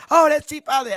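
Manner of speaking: mock thrilled fan squeal; excited fan. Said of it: "They hear his deep voice".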